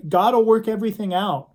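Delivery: imitated chirpy reassurance; glib cheering up. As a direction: brush off a friend's trouble with easy cheer. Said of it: "our temptation is to just say".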